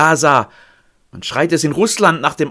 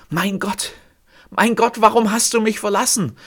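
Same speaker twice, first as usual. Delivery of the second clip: cry; 9 semitones higher than usual. Acted. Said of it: "Sie schreit es".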